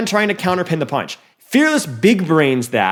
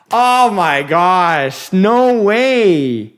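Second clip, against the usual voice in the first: slow, drawn out oh my gosh, louder; stunned disbelief; 2 semitones higher, 4 dB louder, much slower than usual. Real, not acted. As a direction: let the disbelief stretch out. Caster in disbelief.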